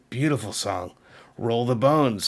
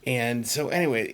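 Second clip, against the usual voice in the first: normal talking voice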